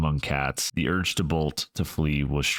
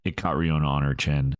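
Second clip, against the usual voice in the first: ordinary delivery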